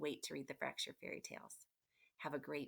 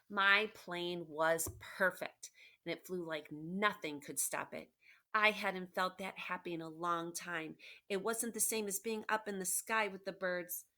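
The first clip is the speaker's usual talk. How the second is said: storybook read-aloud, a bit louder and lively; reading to children; 2 semitones higher, 5 dB louder than usual. Acted. Teacher reading the book aloud to her class, lively. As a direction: read Humpty's words to the class.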